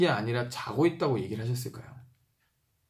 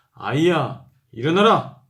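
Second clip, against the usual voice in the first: loud "아이야, 일어나라!" with a rising call; exclaimed version; 3 semitones higher, 8 dB louder, slower than usual. Shown as a demonstration. Pastor showing the exclamation mark in Jesus's command, loud. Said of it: "근데 여기 느낌표가 있어요".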